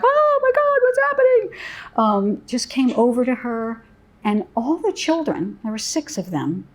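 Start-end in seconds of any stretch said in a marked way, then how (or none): 0.0-1.6 shrill high panicked cry, much higher; frantic grown-up pretending to panic; panicked adult. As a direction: rush in acting scared for the child. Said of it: "scare her by coming like".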